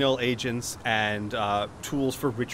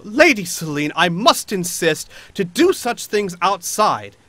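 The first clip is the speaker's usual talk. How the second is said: high, louder, fretful halfling; nervous high-pitched voice; 5 semitones higher, 8 dB louder than usual. Acted. Nervous halfling protesting to his lady, high-pitched. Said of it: "a very nervous, high-pitched voice go".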